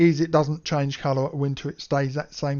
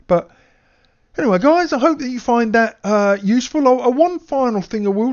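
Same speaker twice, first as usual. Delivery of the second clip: upbeat sign-off; 6 semitones higher, 7 dB louder than usual. Real, not acted. Loud cheerful sign-off to viewers.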